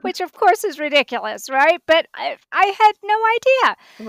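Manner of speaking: loud, high, talking through real laughter; laughing self-mockery. Real, not acted. Mother admitting her naive answer to the interviewers, laughing. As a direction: laugh at your own silliness while talking.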